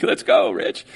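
very high, eager "Let's go, Rich"; eager cheer. Retold or imitated. Italian brother cheering the pastor on, very high eager. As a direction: squeal it like a friend begging to go. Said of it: "You're like".

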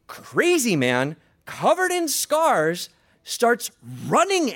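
high, loud, drawn-out drama; alarmed storytelling. A narrator's reading. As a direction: sound the alarm, stretching the key words. Madman charges, preacher dramatizes danger.

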